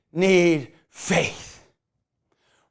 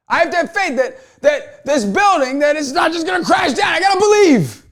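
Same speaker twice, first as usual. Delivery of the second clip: rising, loud, faster preacher's cry about faith; preaching at full force; 8 semitones higher, 8 dB louder than usual. Acted. Preacher urging the crowd to have faith, loud and rising. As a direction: lift the room with each line, building to a shout.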